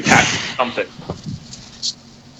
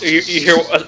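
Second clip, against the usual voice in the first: ordinary talk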